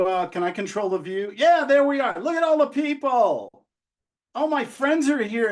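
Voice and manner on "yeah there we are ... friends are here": delighted, higher and louder exclamation; excited. Real, not acted. Host finally sees all the people in the gallery, excited. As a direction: burst out happily as the crowd appears. Delighted at seeing the online audience.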